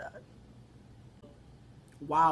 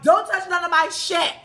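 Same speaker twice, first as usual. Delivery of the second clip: loud, high mock scolding; angry scolding; 9 semitones higher, 8 dB louder than usual. Woman warns intruders off her stuff, angry scolding. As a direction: imitate an irate woman warning you off.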